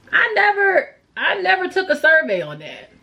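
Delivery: high, exasperated complaint; indignant. Real, not acted. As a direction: complain that nobody asked you. Ranting about the new packaging.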